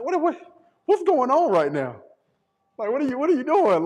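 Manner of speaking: high, rising bewildered protest; shocked disbelief. Retold or imitated. Caught off guard by a friend's sudden request, baffled and high. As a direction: sound like you can't believe what's happening.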